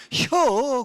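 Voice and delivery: loud, high, stretched Chungcheong reply; exaggerated Chungcheong one-syllable answer; Chungcheong dialect. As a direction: punch out one comic Chungcheong word. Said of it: "충청도 사람들은 얼마나 짧은지".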